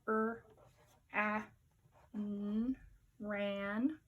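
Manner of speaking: r, a, n sounded out then ran; sounding out ran. Shown as a demonstration. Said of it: "You sound that out?".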